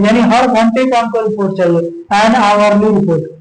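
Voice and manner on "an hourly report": an hourly report drawn out, hourly said as aʋarli; slow reading. Shown as a demonstration. Teacher slowly reading an hourly report to students. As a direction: spell it out slowly, h silent. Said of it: "hourly report".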